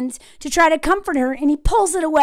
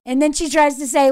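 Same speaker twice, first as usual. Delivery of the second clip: normal talking voice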